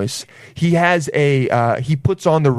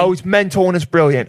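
higher, put-on English accent; mock British accent; 4 semitones higher than usual. Returned student showing off to friends, mock British accent. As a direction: gush like a fake Brit. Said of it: "You're not from there".

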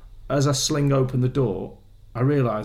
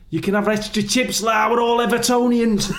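imitating the chip shop bloke; 10 semitones higher, 4 dB louder than usual. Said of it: "he goes".

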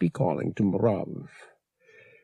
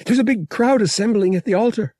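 quick, high and louder; excited; 12 semitones higher, 6 dB louder, faster than usual. A narrator's reading. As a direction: rush out the exciting news. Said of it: "she said excitedly".

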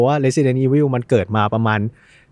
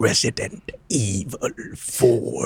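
game title announcer; 2 semitones lower, 7 dB quieter, much slower than usual. Retold or imitated. Host announces the game title like its intro announcer. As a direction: boom it out like the game's intro voice. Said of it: "ของแท้ต้องมีเสียงแบบนี้".

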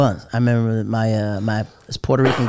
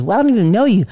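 put-on girl's reply; 9 semitones higher, 4 dB louder, faster than usual. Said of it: "She goes".

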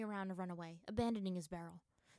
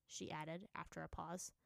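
plain reading voice

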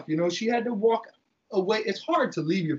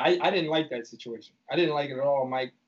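ordinary talk, nothing marked

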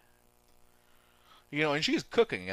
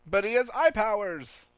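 high, raised mock-worried cry; mock alarm; 10 semitones higher, 6 dB louder than usual. Character warning of villain's eye powers, mock alarm. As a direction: warn them in hammed-up alarm.

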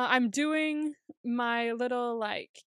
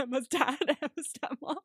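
higher, giggling through the list; real laughing; 4 semitones higher than usual. Real, not acted. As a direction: keep listing through the laughter. Cracking up at cousin's story.

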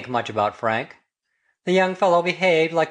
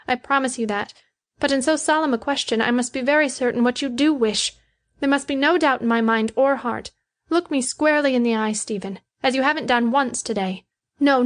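higher-pitched character voice, solemn; earnest, compliant Betty; 8 semitones higher than usual. A narrator's reading. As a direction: voice the woman, pitched well up and sincere.

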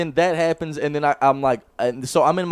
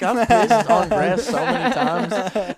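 indignant claim; 8 semitones higher, 5 dB louder, slower than usual. Retold or imitated. Defending himself to friend.

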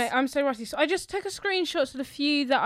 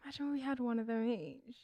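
whisper; 12 dB quieter than usual. Real, not acted. Girly daydream aside.

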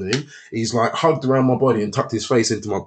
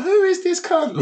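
very high, baffled question; retold incredulity; slower than usual. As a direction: squeak it out in mock disbelief. Baffled again by flashy car.

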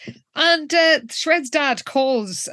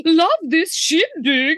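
put-on slow, high old-timer voice; a creaky old guest having fun; lightly voiced old man; elderly extra; 7 semitones higher, 4 dB louder, slower than usual. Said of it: "an elderly extra go".

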